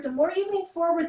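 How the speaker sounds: normal talking voice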